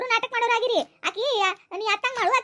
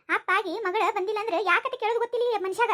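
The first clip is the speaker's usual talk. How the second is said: ordinary delivery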